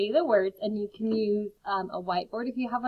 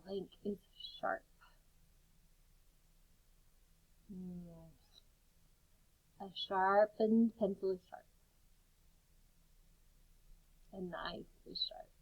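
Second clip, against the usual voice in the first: soft voice; 2 semitones lower, 9 dB quieter than usual. Shown as a demonstration. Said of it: "in a whisper voice".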